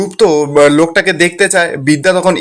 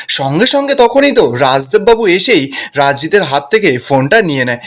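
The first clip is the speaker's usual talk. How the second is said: plain reading voice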